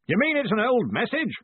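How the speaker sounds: hearty, forceful boss; blustering query. A narrator's reading. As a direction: call the question out impatiently. Ridcully questions loudly.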